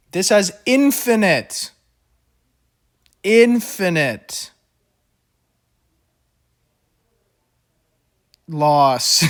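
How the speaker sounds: loud and high, infinite dragged out slowly; emphatic. Shown as a demonstration. Tutor hammering home the unlimited loss, loud and drawn out.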